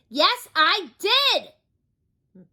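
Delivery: very slow, high, loud shout; top-of-voice shout. Acted. Mister Noisy shouts at the butcher, full shout. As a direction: bellow each word. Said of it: "shouted Mister Noisy at the very top".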